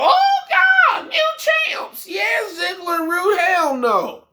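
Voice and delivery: sarcastic hammed-up celebration; mock joy. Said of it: "jump for joy and go".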